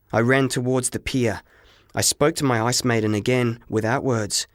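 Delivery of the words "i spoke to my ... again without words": ordinary delivery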